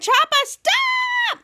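piercingly high, drawn-out shriek; super scream. Retold or imitated. Mika stopping Chopper's attack, acted super scream. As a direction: stretch "stop" into a huge cartoon scream. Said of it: "she used her super scream".

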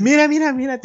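laughing sing-song coax; mock coaxing. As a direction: coax a crying kid with a treat. Bribing crying sibling.

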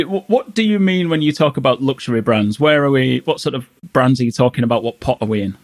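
ordinary delivery

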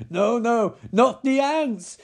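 hammed-up horror building to a scream; high, drawn-out shouts of mock agony; Nicolas Cage. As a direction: scream like an overacting film victim. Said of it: "his best Nicolas Cage in a fucking Wicker Man".

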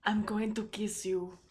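put-on creepy Israeli accent, slow and hushed. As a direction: seductive line in an Israeli accent. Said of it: "I need to do it with an Israeli accent".

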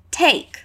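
"Take" said clearly as /tejk/, a long ay sound; clear model word. Shown as a demonstration. Teacher modelling the word Take for students.

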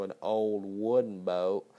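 slow, lightly put-on southern drawl on "an old wooden boat"; a hint of a lazy southern accent, low and unhurried. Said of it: "his somewhat slow southern accent".

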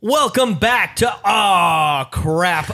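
loud, stretched announcer welcome; big show opening. Pretend. Host welcoming listeners back from break, big shouted intro. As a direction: belt out the show name, drawing it out.

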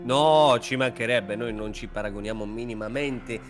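normal talking voice

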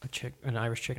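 usual speaking voice

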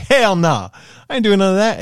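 loud, high retold refusal; emphatic refusal. Retold brother refusing outright.

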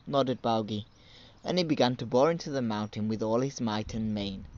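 ordinary delivery, nothing marked

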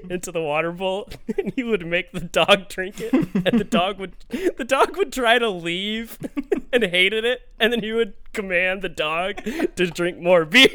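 words broken by giggles; laughing while talking. Real, not acted. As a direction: tell it through bursts of laughter. Laughing while telling dog story.

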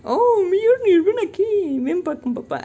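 hammed-up Thor sweet-talking Mjolnir; Thor calling hammer. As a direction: coax the hammer home like a beloved pet. Thor calls his hammer back.

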